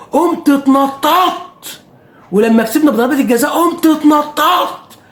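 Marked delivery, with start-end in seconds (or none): 0.1-1.8 high, slow excited telling. Retold or imitated. Fan reliving his goal celebration, high and slow. 3.5-5.0 high, slow shouted telling; excited shout. Retold or imitated. Fan shouting as he relives the penalty win.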